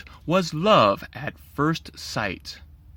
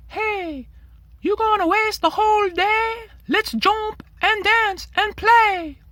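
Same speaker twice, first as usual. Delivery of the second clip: put-on much higher, lively boy's voice; eager Timothy. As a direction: coax him up to play, full of energy. Timothy urges the boy to play.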